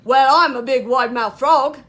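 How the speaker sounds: loud, boastful frog shout; big storybook frog holler. Acted. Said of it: "shouted the wide-mouthed frog".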